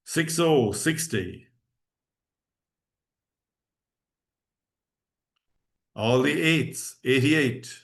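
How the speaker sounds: slow, measured number calling; bingo caller. Acted. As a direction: call each number out clearly.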